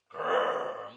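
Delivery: loud rolling growl; monster growl. Acted. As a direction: growl like a big beast. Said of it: "roared a roar".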